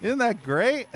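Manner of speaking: high, mock-cheerful sarcasm; sarcastic. Real, not acted. Streamer mocking the glitchy door, sarcastic high praise. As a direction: pretend to be delighted by the bug. Game glitch blocks him.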